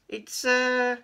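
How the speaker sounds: high hesitant little voice; stall, unsure what to say; nervous cat voice. Acted. Keith hesitating.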